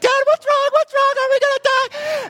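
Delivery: very high, loud imitated child voice; frightened little girl. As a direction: squeal it like a panicking little daughter. Voices his panicking little girl.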